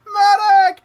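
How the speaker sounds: high, loud drawn-out cry; piercing acted distress call; urgent call for help. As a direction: scream for a medic across the battlefield. Scout calls out for stretcher help.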